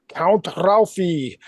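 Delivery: put-on slow, drawn-out Hungarian vampire accent; Bela Lugosi. Buddy greeting him after Transylvania wedding, Bela Lugosi accent. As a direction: stretch it out in a Hungarian Dracula accent. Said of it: "He would say it in, like, Bela Lugosi accent".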